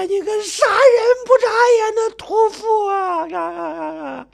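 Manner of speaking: high wailing scream with drawn-out sobs; shrill, full-throated screaming. Acted. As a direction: scream and bawl out your grief, words stretched. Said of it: "声嘶力竭地叫喊着".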